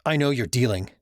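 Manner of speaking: ordinary delivery, nothing marked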